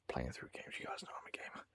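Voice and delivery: hushed whispered aside; whispering. Real, not acted. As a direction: whisper it like a secret.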